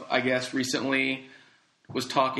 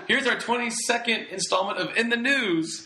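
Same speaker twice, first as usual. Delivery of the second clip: higher, more upbeat intro read; brighter second take; 8 semitones higher than usual. Shown as a demonstration. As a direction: announce it with more lift. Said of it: "Let's try that one more time".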